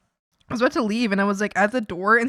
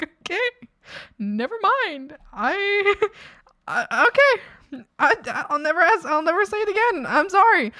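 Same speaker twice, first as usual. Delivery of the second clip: high, timid apologetic voice; meek backing-off apology; 8 semitones higher, 4 dB louder than usual. Retold or imitated. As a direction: shrink back and apologize, much higher. Backing off after being snapped at.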